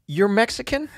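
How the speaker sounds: high, rising mock-puzzled ask; puzzled host question. Radio host asking Steve if he's Mexican, puzzled. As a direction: ask it like you honestly can't place him.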